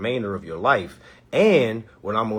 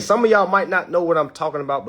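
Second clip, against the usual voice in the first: normal talking voice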